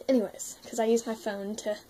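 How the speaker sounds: ordinary delivery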